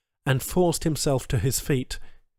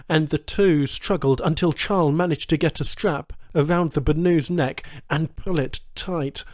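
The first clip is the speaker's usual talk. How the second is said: ordinary delivery, nothing marked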